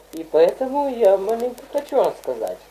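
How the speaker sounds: normal talking voice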